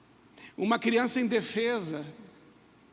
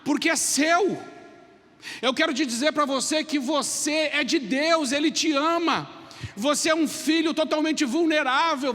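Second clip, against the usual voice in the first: fervent preaching; 4 semitones higher than usual. Acted. Preacher appeal over music.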